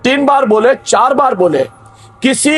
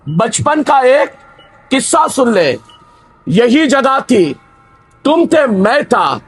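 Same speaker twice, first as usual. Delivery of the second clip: poem recitation. Acted. Recites a nazm in sermon.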